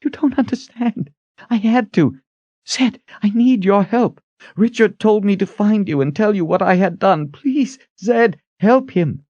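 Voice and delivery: high, pleading character voice; desperate heroine. A narrator's reading. Kalen pleading with enraged Zed, desperate. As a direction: beg for help, pitched well up. Narrator plays Kalen begging.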